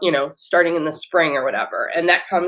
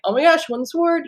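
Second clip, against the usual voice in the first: excited; 10 semitones higher, faster than usual. Retold or imitated. Acting an excited email.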